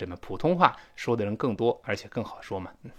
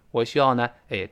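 ordinary delivery

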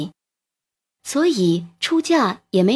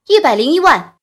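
loud, higher counter-bid; calling a bid; 4 semitones higher, 6 dB louder than usual. A narrator's reading. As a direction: outbid them with a loud call.

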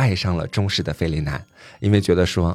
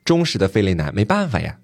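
plain reading voice

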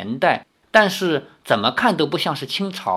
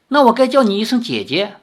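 higher, louder and quick with delight; happy; 10 semitones higher, 6 dB louder, faster than usual. Acted. Zhao Linger happily offers to call Lin Yueru sister, bright and excited. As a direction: beam as you offer to call her big sister. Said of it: "赵灵儿很高兴地说".

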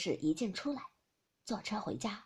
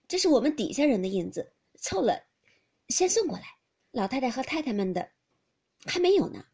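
servant's report; 3 semitones higher, 7 dB louder than usual. Acted. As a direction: report dutifully to the mistress. Servant woman answering.

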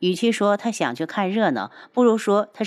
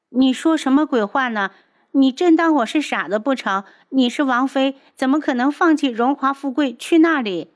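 put-on higher, louder disbelieving girlish voice; shocked indignant girl; 7 semitones higher, 4 dB louder than usual. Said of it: "柳儿震惊地看着他".

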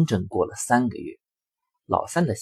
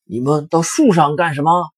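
higher, louder baffled question; puzzled wolf; 6 semitones higher, 7 dB louder than usual. Acted. Wolf puzzled at the pigs up the apple tree, asking. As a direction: ask in real confusion. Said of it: "大灰狼迷惑不解地问".

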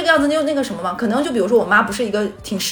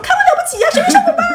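very high, loud mocking outburst; mother dismissing son's meeting excuse; sarcastic scolding; 7 dB louder, faster than usual. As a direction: scoff at the excuse furiously. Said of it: "我妈说".

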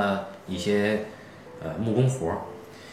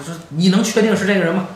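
alarmed; 9 semitones higher, 6 dB louder than usual. Retold or imitated. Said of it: "这女的反应非常大".

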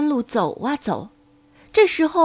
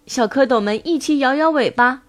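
ordinary delivery

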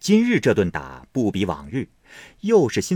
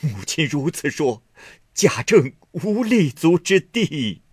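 tearful plea; 7 semitones higher, 4 dB louder than usual. A narrator's reading. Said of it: "连忙叩头哭道".

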